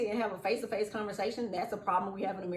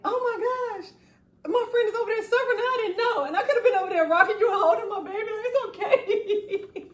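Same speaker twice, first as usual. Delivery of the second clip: laughing, much higher and louder rush of concern; high excited gush; 12 semitones higher, 6 dB louder, faster than usual. Retold or imitated. Friend learns of her friend's struggle, gushing high and laughing. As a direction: gush over a suffering friend through laughter. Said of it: "because I'm like".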